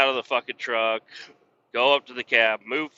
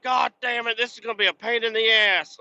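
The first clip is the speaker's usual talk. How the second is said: high, exasperated griping; retold high-pitched complaint; complaining boss; 9 semitones higher than usual. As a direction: mimic a put-out boss with the pitch pushed up. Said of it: "He's like".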